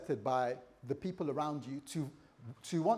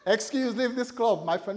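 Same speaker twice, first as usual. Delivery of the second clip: club crowd dismissing; 3 semitones higher, 7 dB louder than usual. Acted. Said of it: "They say".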